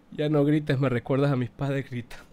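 soft, drained murmur; low and subdued. Real, not acted. Streamer reading a viewer's plea to stop yelling, subdued. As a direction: read it out low and spent. Reading chat after shouting himself out.

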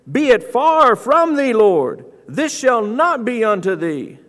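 high, emphatic rebuke in Peter's role; rebuking. Retold or imitated. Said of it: "began to rebuke him".

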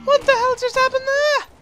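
high-pitched, shocked exclamation; startled outburst. Real, not acted. Gamer reacts to a sudden zombie mishap, startled outburst. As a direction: let it leap up high in disbelief.

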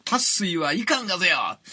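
theatrical one-line rebuke in Tosa dialect; retold scolding. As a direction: call it out like a dramatic telling-off. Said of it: "その説教の一言が".

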